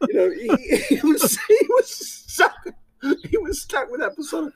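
high, laughing while speaking. Real, not acted. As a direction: chuckle through the words. Laughing at own story.

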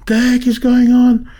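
high, loud baffled outburst; sudden high burst of confusion; shocked. Retold or imitated. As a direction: blurt it out in disbelief. Retold shock at being answered.

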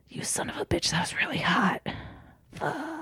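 hushed, swooning half-whisper; mock-smitten whisper. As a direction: whisper it, grudgingly turned on. Heroine secretly aroused, acted.

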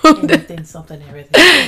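emphatic echo. Pretend. Echoing emphatically.

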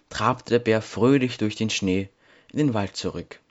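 plain reading voice

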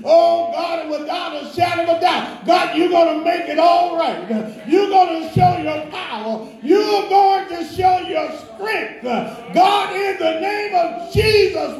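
high, impassioned prayer shout; full-force prayer. Real, not acted. Praying for Sister Cindy to God, high impassioned shout. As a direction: cry out each promise, pitched high.

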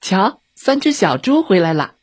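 high, mild shout; calling out. A narrator's reading. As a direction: call out as if spotting someone. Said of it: "小红帽指着窗户大叫了一声".

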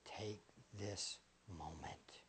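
soft, low whispered reverence, mostly unvoiced; hushed devotional. Preacher whispering to the congregation about eternity. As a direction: breathe it out like a quiet prayer. Hushed closing of a prayer-like passage.

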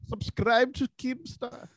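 put-on slow, hammy Indian accent; drawn-out fake Indian accent; bad Indian accent. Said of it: "say it in a shitty Indian accent".

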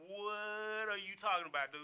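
loud, slow mock bewilderment; imitated incredulous reaction; baffled friends. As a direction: stretch it out, acting out confused buddies.

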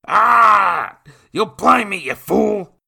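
very loud, high pained scream. Acted. Nathan to William, screaming in pain. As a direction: howl as the eye is gouged. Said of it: "Nathan screamed".

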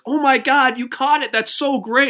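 hammed-up squealing praise; over-the-top gushing. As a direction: gush like an overexcited fan. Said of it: "she was so over the top".

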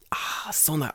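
hushed unvoiced admission; whispered concession. NHK man caught out, whispered. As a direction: breathe it out, defeated. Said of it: "He's like".

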